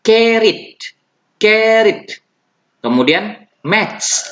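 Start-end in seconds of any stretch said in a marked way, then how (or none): 0.0-2.2 "Carried" tinggi dan keras, /kerit/; high clear model. Shown as a demonstration. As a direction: ucapkan "Carried" lantang sebagai contoh. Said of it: "Kemudian carried". ("Carried" diucapkan tinggi dan keras)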